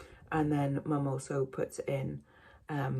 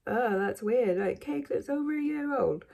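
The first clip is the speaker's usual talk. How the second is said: higher-pitched incredulous quote; lightly mocking, higher voice; mock disbelief; 6 semitones higher than usual. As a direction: imitate someone puzzled and put off. Voicing a viewer's objection.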